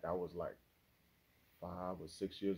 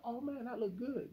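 impressed gush. Retold or imitated. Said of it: "And I was like".

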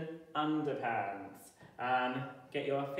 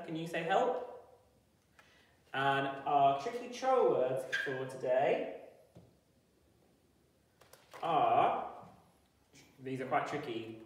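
ordinary delivery

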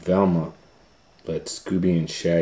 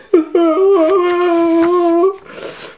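panicked high laugh; 7 dB louder than usual. Acted.

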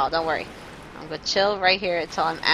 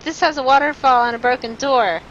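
loud, higher announcement; calling out; 4 semitones higher, 7 dB louder than usual. Real, not acted. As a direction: call it out to get their attention. Calls out to advisers.

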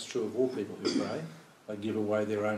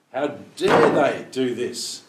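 slow, louder outraged cry; indignant; 8 dB louder than usual. Retold or imitated. Teacher voicing an indignant God, slow loud outrage. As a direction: let the outrage ring out slowly.

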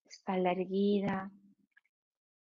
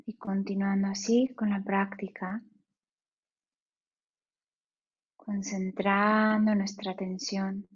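guided meditation. Acted. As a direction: ease them into stillness, unhurried.

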